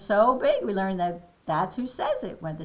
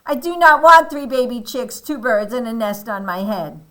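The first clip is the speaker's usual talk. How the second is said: angry protest; 3 semitones higher, 10 dB louder than usual. Acted. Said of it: "Elephant looks very angry".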